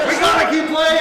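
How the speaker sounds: high, loud pleading; desperate begging. Acted. Injured Edmund pleads with the players, high desperate begging. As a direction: beg them not to stop.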